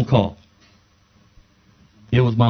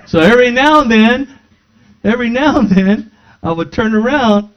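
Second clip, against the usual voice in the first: high, loud building excitement; lively storytelling; 8 semitones higher, 6 dB louder than usual. Real, not acted. Preacher building excitement telling congregation his story. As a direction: swell it up for the crowd.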